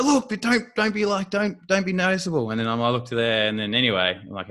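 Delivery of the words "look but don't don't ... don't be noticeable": much higher, fast imitated fluster; excited wife. Wife warning husband not to stare at the celebrities, high flustered voice.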